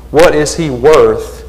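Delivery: ordinary delivery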